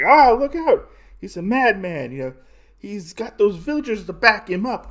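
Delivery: high-pitched panicked warning, hammed up; mock alarm. Brigands warning each other about the charging madman, mock alarm. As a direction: cry out like a startled henchman.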